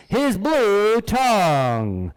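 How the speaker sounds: very slow, stretched-out "blue tongue"; dramatic reveal. Acted. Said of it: "one more time".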